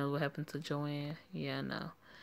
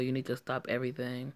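normal talking voice

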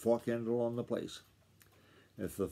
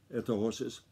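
usual speaking voice

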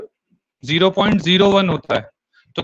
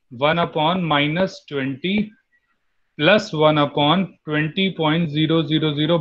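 slow dictation; much slower than usual. Shown as a demonstration. Dictating formula slowly to class.

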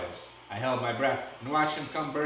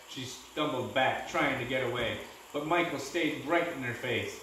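plain reading voice

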